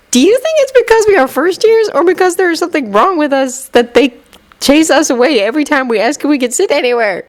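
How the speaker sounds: put-on high earnest boy; puzzled little boy; young boy voice. A narrator's reading. First-year boy voiced.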